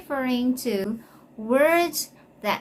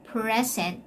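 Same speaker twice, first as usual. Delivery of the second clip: "Present" stressed at the front; the noun "Present", PREZ-ent; stress on first syllable. Shown as a demonstration. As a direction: lean on PRE in "Present". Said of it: "take note of the stress".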